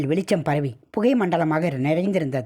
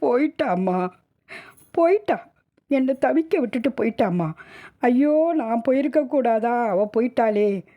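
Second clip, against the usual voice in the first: wailing grief; 6 semitones higher than usual. Acted. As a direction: wail as a bereaved woman. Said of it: "அலறினாள்". (கதறி அலறும் அழுகை)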